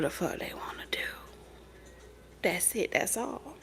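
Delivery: whispered, half to herself. Real, not acted. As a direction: breathe it as a whisper. Whispered aside.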